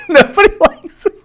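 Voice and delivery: high-pitched laughing repeat. Real, not acted. Laughing hard at the line about Bernie. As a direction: laugh through the words in delight.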